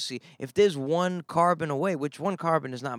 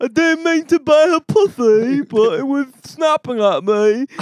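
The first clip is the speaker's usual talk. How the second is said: high, slow, much louder mock English accent; 10 semitones higher, 11 dB louder, slower than usual. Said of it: "that sounds like [name]".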